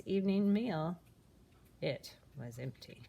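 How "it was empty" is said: soft, low dismayed narration. A narrator's reading. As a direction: let it drop, quiet and sad. Sad climax.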